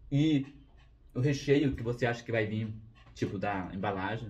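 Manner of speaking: talking through a bite of cake; chewing between words; mouth full, chewing. Real, not acted. Seen 0: Right speaker bites cake at 0.9, then talks with mouth working.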